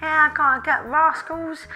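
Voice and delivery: loud, broad casual British accent. Shown as a demonstration. Casual client chatting about the kids, loud broad accent. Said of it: "If they speak casual".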